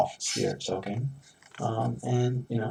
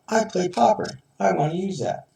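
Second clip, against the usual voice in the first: high excited quote; 9 semitones higher, 4 dB louder than usual. Retold or imitated. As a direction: voice jumps up high, a bit louder. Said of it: "sometimes you're like".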